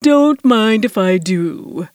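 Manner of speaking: slow, slick, oily voice; smooth as oil over water; oily villain. A narrator's reading. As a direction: draw it out with unctuous relish. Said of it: "voice that reminded me of light crude oil spreading".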